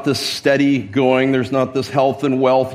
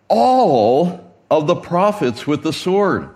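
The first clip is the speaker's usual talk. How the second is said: "All" drawn long and higher; emphatic ALL; 4 semitones higher, slower than usual. A narrator's reading. Preacher reads the verse on the slain prophets, emphatic "All". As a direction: hit "All" hard, stretch it, then carry on. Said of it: "That all is emphatic here".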